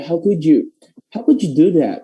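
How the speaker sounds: "how could you" run lightly together; casual, linked delivery; quick relaxed reading. Shown as a demonstration. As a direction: toss it off without emphasis. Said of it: "Cara bacanya mungkin cepet gitu ya".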